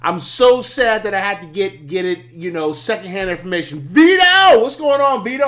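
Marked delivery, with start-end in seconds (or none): 3.8-5.5 loud, high delighted hail; calling out a greeting. Real, not acted. Streamer spots a friend joining, calls out loudly. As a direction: break off and call out the name, high and loud. Greets viewer arriving mid-sentence.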